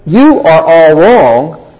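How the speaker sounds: slow and firm, all pressed; firm declaration. Retold or imitated. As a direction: lay it down like a verdict. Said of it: "our Lord is saying this".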